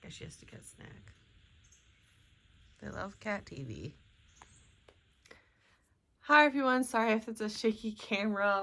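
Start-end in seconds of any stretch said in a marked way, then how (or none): 0.0-1.1 whispering. Real, not acted. Aside while cat watches. 2.8-4.0 hushed. Real, not acted. Quiet morning filming beside watching cat.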